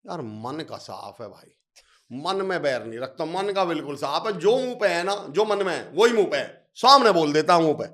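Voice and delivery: mock defensive praise. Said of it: "हम ही क्या बोलते हैं". (मुंहफट आदमी की तरफ़दारी, धीरे शुरू होकर ज़ोरदार)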